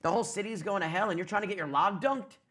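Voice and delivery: period cop. Acted. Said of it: "There was a cop saying".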